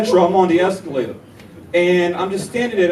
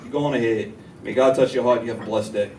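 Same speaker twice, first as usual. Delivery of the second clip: mock sweet; 6 semitones lower than usual. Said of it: "I was like".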